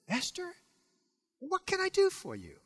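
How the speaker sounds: the king urging Esther to speak; eager pleading king. Acted. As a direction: beg her to tell him, leaning in.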